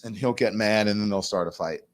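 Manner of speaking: ordinary delivery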